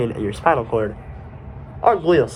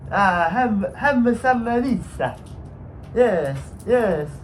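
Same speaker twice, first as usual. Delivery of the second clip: higher put-on French accent; 7 semitones higher, much slower than usual. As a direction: play a cook with a French accent. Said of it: "I just like to use that accent".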